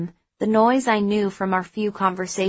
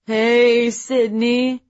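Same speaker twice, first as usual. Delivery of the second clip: put-on cheerful drunken slur; 4 semitones higher, 5 dB louder, much slower than usual. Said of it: "Spence slurred at me cheerfully".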